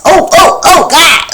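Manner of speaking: high, loud horrified cry; mock horror. Imagining a stranger slashing her face, loud horrified cry. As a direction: shriek as if someone cut your face.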